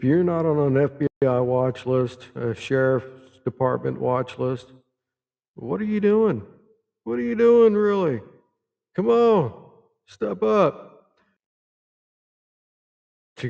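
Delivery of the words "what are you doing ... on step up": high-pitched, exasperated demand; incredulous, raised-voice challenge. Real, not acted. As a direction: pitch it way up and goad them. Challenges the anonymous accuser to act.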